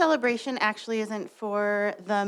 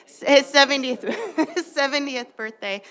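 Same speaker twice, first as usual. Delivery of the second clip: higher, laughing through the words; 6 semitones higher, 7 dB louder than usual. Real, not acted. Board speaker teasing Mister Horst about his birthday, laughing. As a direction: break into a real laugh while announcing it.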